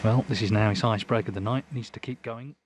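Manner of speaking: normal talking voice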